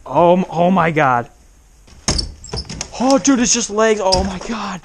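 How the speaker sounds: usual speaking voice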